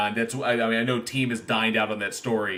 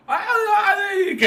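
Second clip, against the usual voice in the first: much higher, louder warbling wail; 5 dB louder than usual. Retold or imitated. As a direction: imitate a weird stage wail. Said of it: "[name] starts doing, like, this weird".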